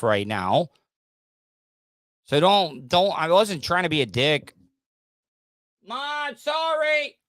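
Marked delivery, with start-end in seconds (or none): 5.9-7.2 high yell to someone in another room; shouted apology. Real, not acted. Host yelling sorry to his mom from another room. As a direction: call out sorry across the house.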